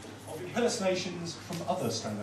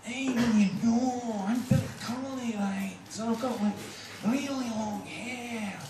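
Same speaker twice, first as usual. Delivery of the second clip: high, stretched-out comedian voice; high, rambling stand-up parody; Billy Connolly; 8 semitones higher than usual. Acted. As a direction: draw the words out high and loose. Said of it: "that is Mister Billy Connolly".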